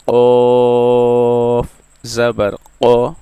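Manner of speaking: teacher demonstrating the heavy qaf; slow spelled recitation. Shown as a demonstration. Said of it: "आइए practice करते हैं".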